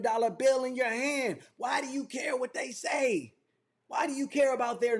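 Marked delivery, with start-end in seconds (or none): none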